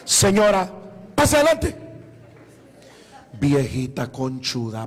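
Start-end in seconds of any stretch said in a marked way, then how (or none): 0.0-1.8 loud, high invitation; calling her in. Retold or imitated. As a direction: call her inside from across the room. Boy invites woman in loudly.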